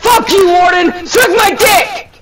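high, loud screaming insults; furious shout. Real, not acted. Gamer screaming at enemy Warden after dying, furious yell.

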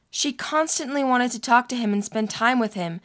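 ordinary delivery, nothing marked

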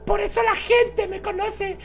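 very high, squeaky cutesy voice; squeaky girl character. Acted. The girl greets her prince, in a very high squeaky voice. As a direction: play the ditzy princess, all squeak.

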